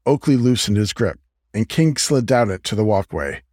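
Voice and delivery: ordinary delivery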